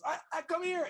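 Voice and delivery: high, loud stammered call; urgent summons. Acted. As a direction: shout for help in a fluster. Dwarf boss summons secretary urgently.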